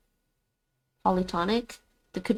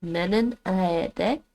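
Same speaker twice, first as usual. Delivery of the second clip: slow try; much slower than usual. Shown as a demonstration. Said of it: "Or aede menen".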